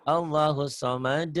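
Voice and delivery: measured Quran recitation; recitation demo. Shown as a demonstration. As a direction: recite evenly for the student.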